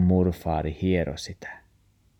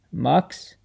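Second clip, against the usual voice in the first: questioning call; 6 semitones higher than usual. Acted. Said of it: "murfaari kysyy".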